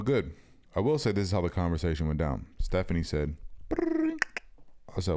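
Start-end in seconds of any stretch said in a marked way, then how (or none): 3.7-4.2 high rolled-lips phone ring; imitated phone buzz. Friend retelling a call, buzzing like a phone. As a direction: mimic the phone ringing. Phone ringing sound effect.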